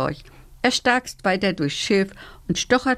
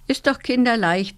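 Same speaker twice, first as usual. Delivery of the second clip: usual speaking voice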